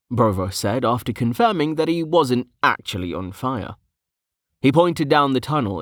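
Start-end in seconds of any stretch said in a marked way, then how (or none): none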